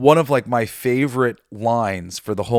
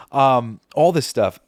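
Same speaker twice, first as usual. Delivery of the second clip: usual speaking voice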